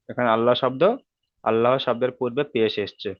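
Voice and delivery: normal talking voice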